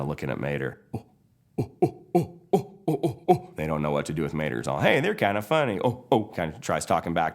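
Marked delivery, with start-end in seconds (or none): 0.9-3.5 high, excited caveman oohs; cavemen grunts. Acted. Stone wheels react to Mater. 4.8-6.2 higher, amused country voice; tickled Mater. Acted. Mater talks back to wheels.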